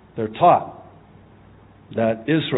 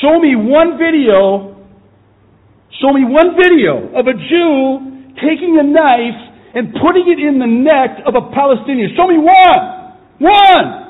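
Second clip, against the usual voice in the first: yelling; 11 dB louder than usual. Real, not acted. Preacher yells at the congregation about the attacks, furious shout. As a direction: shout the challenge, fully enraged. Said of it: "Stop yelling, you're blowing out my speakers".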